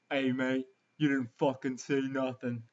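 rough British bloke telling someone off, lightly done; a lightly voiced British accent, a bit gruff; British accent impression. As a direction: shoo the kid off in a British accent. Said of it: "That's my British impression".

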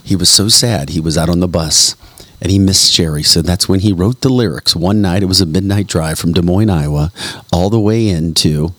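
usual speaking voice

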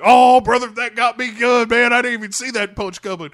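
loud, high dumb bro; hammed-up jock buddy; big dumb bro shrugging off a punch. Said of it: "Then it's just like".

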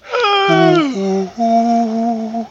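loud helpless laughter into tears; crying with laughter. Real, not acted. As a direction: laugh till it hurts. Laughing to tears at own joke.

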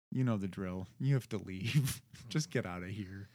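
low, quiet, world-weary cop imitation; retold police officers. Police tell a man to get out, retold as quiet routine orders. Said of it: "police showed up and they were like".

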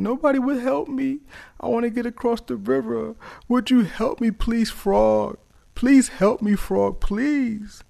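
high, pleading creature voice; begging scorpion. Acted. Scorpion begs frog for help crossing, high pleading voice. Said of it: "crying and, and begging for help".

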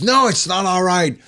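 high-pitched mock indignant retort; imitated yelling. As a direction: play the star refusing to be calmed. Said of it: "He starts yelling at people".

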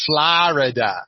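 Florida said as "Flarida", dropping the first vowel to a flat a; funny Florida. Shown as a demonstration. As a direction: Florida squeezed to two quick syllables, "Flah-ruh-duh". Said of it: "I just thought that pronunciation was hilarious".

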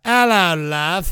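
loud mock British greeting; British accent. Co-host trying out the pickup line, mock British. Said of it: "in the British accent".

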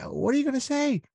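high, squeaky panicked question; panicked inner voice. Retold or imitated.

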